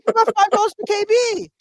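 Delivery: high, loud mock child yell; protesting kid. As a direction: imitate a kid shouting back in protest. Kid protesting to his angry dad.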